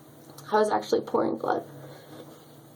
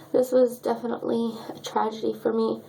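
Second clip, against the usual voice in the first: ordinary talk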